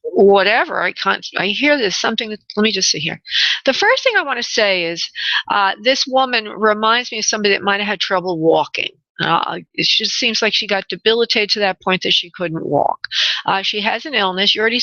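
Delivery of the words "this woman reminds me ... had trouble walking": normal talking voice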